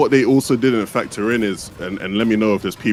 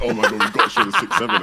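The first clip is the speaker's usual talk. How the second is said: imitated excited producer; 11 semitones higher than usual. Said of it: "so excited".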